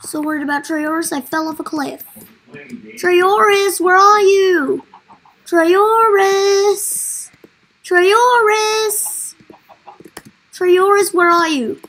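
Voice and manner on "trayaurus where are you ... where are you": loud, drawn-out calls for someone lost; calling out. Pretend. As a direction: call out loudly, stretching the name. Calling out for missing villager.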